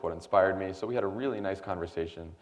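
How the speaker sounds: ordinary talk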